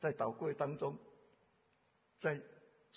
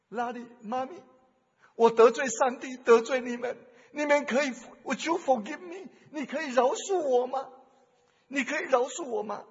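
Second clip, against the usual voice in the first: daughter's plea; 7 semitones higher, 10 dB louder than usual. Retold or imitated. Runaway daughter begging father's forgiveness.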